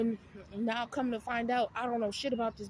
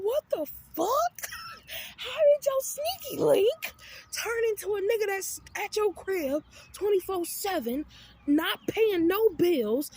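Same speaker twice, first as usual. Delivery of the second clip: exasperated rant; 4 semitones higher than usual. Retold or imitated. Ranting at the roommate about the freeloading boyfriend, high and outraged. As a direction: lay into her like she's lost her mind. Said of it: "And I'm just like".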